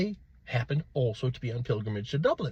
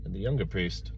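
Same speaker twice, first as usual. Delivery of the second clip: ordinary delivery, nothing marked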